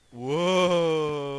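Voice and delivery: loud long whoa of awe; amazed whoa. Real, not acted. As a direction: gasp out loud at the cave. Seeing huge cave.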